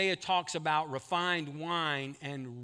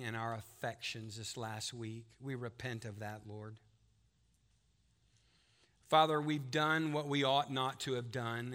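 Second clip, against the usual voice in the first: hushed, reverent prayer voice; 3 semitones lower than usual. Real, not acted. Pastor praying for the church, hushed and reverent.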